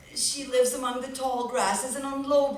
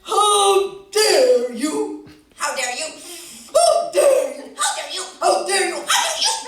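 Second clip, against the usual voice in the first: higher, much louder indignant shouts; squabbling birds; 8 semitones higher, 13 dB louder than usual. Acted. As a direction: hurl it back and forth. Birds quarrelling, shouted insults.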